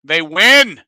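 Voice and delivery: loud, announcer-like reading of a handbook; mock formal presentation. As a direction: present it like a slightly hammed-up host. Reads training handbook aloud.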